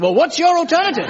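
high, loud whiny comeback; hammed-up smug partner; smug retort. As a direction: defend the indefensible with a shrug. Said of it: "They say".

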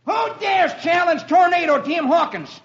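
high, put-on boy's voice; young boy shouting down. A narrator's reading. Boy on cliff threatening Roy's party, high boyish shout. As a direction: call out a cocky threat as a little gunslinger. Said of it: "a young boy ... shouts down".

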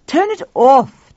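loud, slow command; demanding. A narrator's reading. Tuffy ordering the music off, loud slow demand. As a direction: order it off firmly. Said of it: "Tuffy demanded".

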